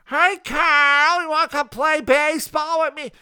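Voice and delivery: high, loud cartoon kid voice; squeaky-voiced kid calling to play ball; Carl impression. Vlogger imitating cartoon kid Carl, high and loud. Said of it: "Carl talks like this".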